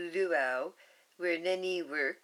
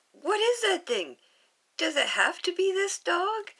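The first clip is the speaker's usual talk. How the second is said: much higher, louder shocked voice; shocked big sister; 12 semitones higher, 7 dB louder than usual. Acted. Big sister Nani, shocked at the dog, higher and louder. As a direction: recoil at the ugly dog in disbelief.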